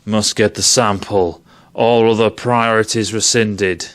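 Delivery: flat command read out with a hint of a machine; Mother computer voice. Ranting about the film, he voices the ship's computer Mother with a hint of a machine. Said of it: "Mother has a voice and is a woman".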